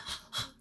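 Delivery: a quick unvoiced gasp of pain; sharp pained gasp. Imitating Jordan's hand hurting, a sharp gasp. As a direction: suck air in sharply like it hurts. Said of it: "He was like".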